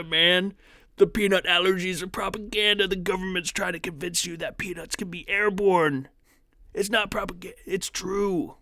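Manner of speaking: high, loud, put-on ranting conspiracy voice; mock conspiracy nut. Streamer mocking commenters as a high-pitched conspiracy theorist. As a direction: play a paranoid crank insisting peanuts are a hoax.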